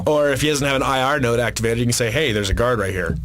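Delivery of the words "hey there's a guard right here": a low, hushed warning to a teammate; lower voiced callout. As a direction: point out the guard in a low voice. Player calling out a guard.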